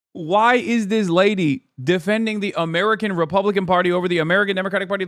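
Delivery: ordinary talk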